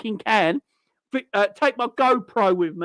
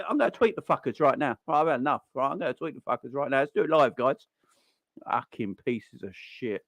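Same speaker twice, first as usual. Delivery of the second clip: impression of a fan, lower and softer; muttering like a fed-up online fan; mock angry fan; 7 semitones lower, 5 dB quieter, faster than usual. Fan vowing to tweet at the owners, hammed-up. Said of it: "I don't know what that impersonation was".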